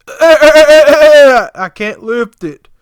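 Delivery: loud, high, drawn-out goat bleat; mock a straining wail like a slaughtered goat. Mocking helper who can't lift the trimmer, goat-like bleat. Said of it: "making a noise like a goat getting, you know, his throat slit".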